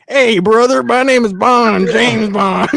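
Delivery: put-on a Bond line hiding a Southern accent. Teasing a friend's Bond impression with a Southern twang. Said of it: "sound like someone who's trying to hide, like, their southern accent".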